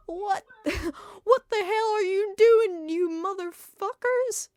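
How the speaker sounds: put-on high, loud cracking old-man voice; strained falsetto wizard yelling; Dumbledore attempt. Headmaster catching the couple, failed high wizard yell. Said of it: "It was Dumbledore".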